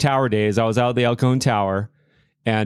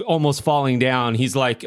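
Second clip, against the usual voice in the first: ordinary delivery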